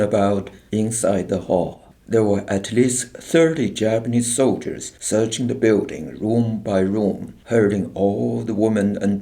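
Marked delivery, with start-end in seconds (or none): none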